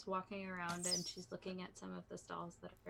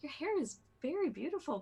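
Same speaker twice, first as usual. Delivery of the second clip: higher, louder admiration; gushing compliment; 9 semitones higher, 9 dB louder, faster than usual. Acted. Lasha compliments the merchant's hair, gushing and high. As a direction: gush about it, voice lifted.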